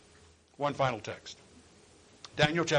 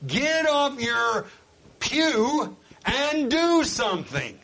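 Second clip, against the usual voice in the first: high, loud, voice breaking with feeling; full-force preaching; 9 semitones higher, 5 dB louder, slower than usual. Real, not acted. Preacher urging the congregation, high and loud with breaking voice. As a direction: preach it hard with a catch in the throat.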